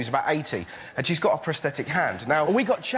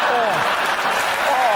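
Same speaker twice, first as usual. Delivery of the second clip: louder, drawn-out stunned oh; stunned preacher; 6 semitones higher, 5 dB louder than usual. Retold or imitated. Preacher silenced by the comeback, loud stunned oh. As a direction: let the preacher be lost for words. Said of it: "he just sat there going".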